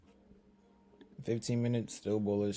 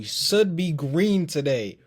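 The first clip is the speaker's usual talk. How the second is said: loud, higher, stretched-out words; emphatic hopeful declaration; 6 semitones higher, 11 dB louder, slower than usual. Real, not acted. Trader willing the day to be green, emphatic. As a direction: call it out slowly and forcefully. Insists on a good trading day.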